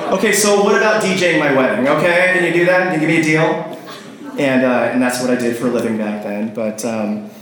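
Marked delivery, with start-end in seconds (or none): 0.1-3.8 higher, hopeful sales pitch; eager retold pitch. Retelling his call to a newly engaged friend, eager pitch. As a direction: blurt out a pitch, acting it out. Abrupt switch to wedding pitch.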